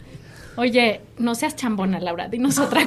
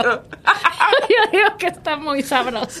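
slow, laughing confession; 5 semitones higher, slower than usual. Real, not acted. Admitting she says sabroso, laughing slowly. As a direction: giggle through it.

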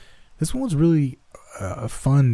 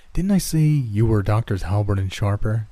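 ordinary delivery

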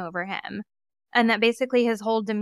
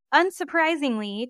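usual speaking voice

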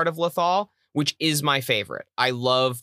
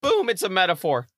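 voice cracking; 6 semitones higher than usual. Real, not acted. One host to another making a quip, voice cracking. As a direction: voice cracks upward on an exclamation. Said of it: "your voice just cracked".

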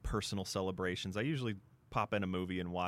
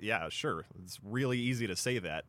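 ordinary talk, nothing marked

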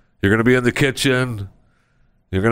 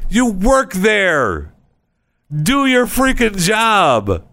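exasperated scolding; 10 semitones higher, slower than usual. Acted. Scolding useless employees.

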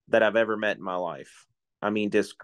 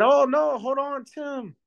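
put-on high, excited Cajun accent, pitched way up; Cajun guy voice; slower than usual. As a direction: play the Cajun fisherman jumping in, a high Cajun accent. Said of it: "this French Cajun guy".

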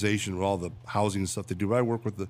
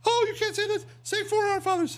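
scolding nun. Retold or imitated. Nun caddy scolding the priest, high falsetto. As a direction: squeak out the reproach like a prim nun.

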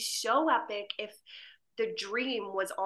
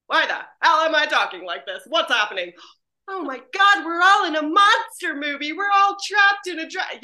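confused shout; 6 semitones higher, 11 dB louder than usual. Retold or imitated. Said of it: "Carlos would be the one to be like".

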